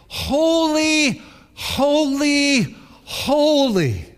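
high, loud ringing holy; seraph's call. Acted. Seraph crying to another, loud and high.

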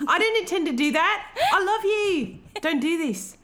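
high-pitched melodramatic begging; mock desperate pleading. Acted pleading scene from the retold story.

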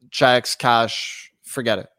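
ordinary talk

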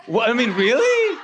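high, loud incredulous outburst; mock disbelief. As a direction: play up the disbelief, pitched right up. Mock disbelief at a town's name.

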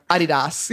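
"Adidas" said the Australian way, AH-dee-dass; "Adidas" in a lightly imitated Australian accent; Australian pronunciation. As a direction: stress the first syllable of "Adidas", Australian style. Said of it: "talking to an Australian person".

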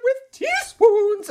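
high, slow falsetto complaint; tenor's indignant aria. Retold or imitated. Retelling the tenor's aria about teaspoons, high and slow. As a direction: whine it way up like a fussy tenor. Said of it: "and he's like".

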